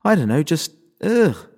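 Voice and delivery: frustrated, breaking into a high ugh; flustered outburst, voice jumping up; exasperated. Acted. Panicked girl gasping after fight.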